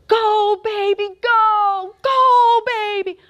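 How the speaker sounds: high, drawn-out holler; cheering on. Acted. God cheering on his children like that dad, high holler. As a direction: cheer like a wildly proud parent. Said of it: "That's exactly what you're like with us".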